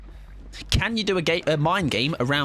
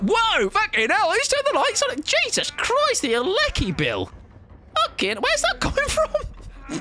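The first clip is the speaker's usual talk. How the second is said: startled yelp. Real, not acted. Streamer dazzled by sudden bright lights, startled yelps. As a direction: cry out as if blinded by light.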